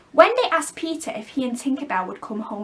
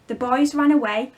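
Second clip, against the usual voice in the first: ordinary delivery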